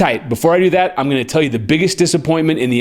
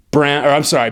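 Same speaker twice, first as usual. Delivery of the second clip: usual speaking voice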